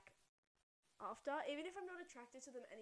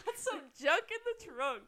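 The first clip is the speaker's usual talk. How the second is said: slow and much louder, teasing; cheeky sing-song; 6 semitones higher, 14 dB louder, slower than usual. Pretend. Echoing her friend's cheeky joke, slow and loud. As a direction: savour every word like a punchline.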